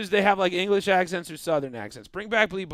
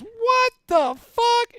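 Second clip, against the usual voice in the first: very high, slow droid squeal; R2-D2 swearing; much slower than usual. Retold or imitated. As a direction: stretch it out like a shocked little droid beeping. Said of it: "D two just looks at me and just goes".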